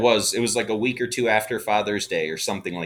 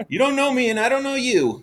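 quoted high voice; 14 semitones higher, 4 dB louder than usual. Retold or imitated. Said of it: "quoting Daryl to Eric".

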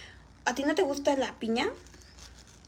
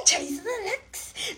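laughing; 7 semitones higher, 3 dB quieter, slower than usual. Real, not acted. Joke about her ex.

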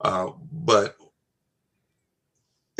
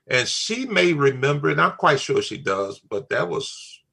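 ordinary talk, nothing marked

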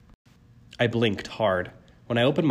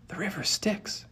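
higher, soft awed murmur; 10 semitones higher, 5 dB quieter than usual. A narrator's reading. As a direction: breathe it out in awe. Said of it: "Annabeth murmured".

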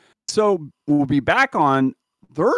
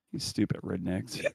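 much lower and softer, under the breath; muttered aside; 10 semitones lower, 13 dB quieter than usual. Retold or imitated. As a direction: grumble it to yourself as you turn away. Larry mutters as he turns away.

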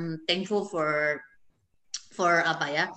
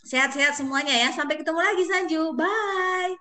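cheery goodbye; 10 semitones higher than usual. Real, not acted. Cheerful farewell to guest.

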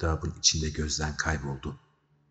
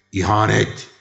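loud, raised cry of accusation; outraged shout; 5 semitones higher, 10 dB louder than usual. A narrator's reading. King shouts treason at the sailor, loudly. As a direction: shout it as one sharp burst. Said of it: "diye bağırdı majesteleri birinci Veba Kralı".

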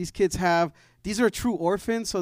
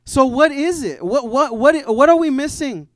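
preaching shout; 8 semitones higher, 10 dB louder than usual. Acted. Preacher's climactic question.

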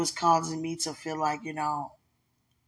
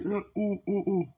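yawning; 5 semitones higher, slower than usual. Real, not acted. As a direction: stretch it into a long yawn. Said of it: "Excuse me for yawning in your ear".

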